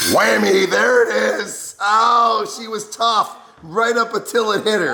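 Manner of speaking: high, gleeful laughing cheer; excited. Real, not acted. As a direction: cheer as the heat hits her. Watching her react to wasabi.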